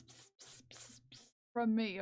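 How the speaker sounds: hissed pss pss to call a cat; whispered cat call; cat-calling psst. Pretend. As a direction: call the cats with soft pss.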